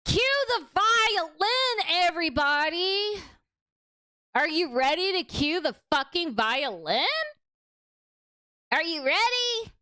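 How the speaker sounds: sarcastic call. Acted. Sarcastic call before the clip.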